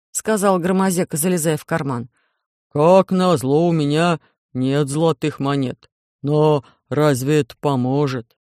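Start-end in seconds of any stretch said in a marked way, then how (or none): 2.7-8.4 low, heavy, dismayed giant; low alien voice. A narrator's reading. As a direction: rumble it like a big clumsy alien.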